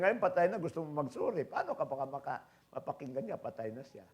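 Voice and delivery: usual speaking voice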